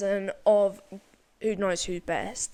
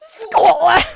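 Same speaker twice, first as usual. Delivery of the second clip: high, loud, yelled Scotland; Scottish accent; 9 semitones higher, 17 dB louder than usual. Retold or imitated. Friend shouts Scotland in an accent. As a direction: bellow it like a wild Scot. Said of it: "you got Scottish".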